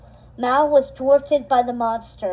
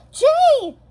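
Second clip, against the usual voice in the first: high, loud warning shout; frantic cry of his name; 6 dB louder than usual. Acted. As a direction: call him from across the hall. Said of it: "shouted Mal".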